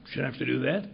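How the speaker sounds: ordinary delivery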